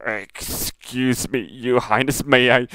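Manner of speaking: slow, strained, nauseous plea; a hint of a man about to be sick; lightly voiced queasy commander. The sick commander asking the princess to leave, slow and strained.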